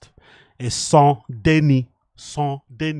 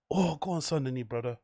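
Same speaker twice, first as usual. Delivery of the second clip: ordinary delivery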